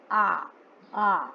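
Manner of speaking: आ held with the mouth wide open; teacher's drawn-out "aa" on आ. Shown as a demonstration. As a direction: open big and sound a long आ. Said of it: "मुंह बड़ा खुल रहा है".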